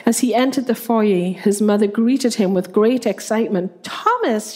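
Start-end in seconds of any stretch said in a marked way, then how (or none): 3.8-4.5 high delighted exclaim; excited exclaim. A narrator's reading. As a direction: greet him with great excitement. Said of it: "she exclaimed".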